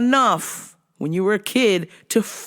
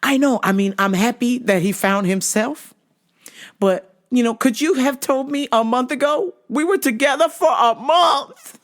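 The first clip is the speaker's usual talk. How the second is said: higher, building up into a laughing near-shout; whiny teen girl voice; 6 semitones higher than usual. Retold or imitated. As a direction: imitate the dumped cousin, getting worked up. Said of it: "she's like".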